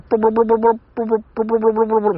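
high, rapid-fire monotone syllables; game-language blips. Retold or imitated. Imitating Dragon Quest speech sounds, in quick high blips. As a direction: rattle it off like scrolling game text. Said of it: "そういうゲーム言語というか".

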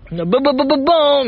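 高い声で「バババババーン」と連射する擬音; 勢いよく高く言い放つ口真似の効果音; 派手な効果音の真似. Retold or imitated. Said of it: "みたいな言う". (派手な擬音の口真似)

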